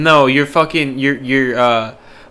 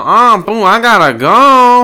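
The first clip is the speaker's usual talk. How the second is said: mock Dale voice; 6 semitones higher, 5 dB louder, slower than usual. Imitating Dale leaving for bathroom.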